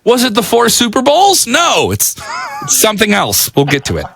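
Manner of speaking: high energetic DJ announcer read; radio DJ break. Shown as a demonstration. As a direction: hype the upcoming songs on air. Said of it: "now I'm gonna do the break".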